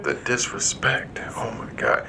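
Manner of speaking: breathless whispered disbelief; whispering. Real, not acted. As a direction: whisper it in shock. Shocked reaction to the joke.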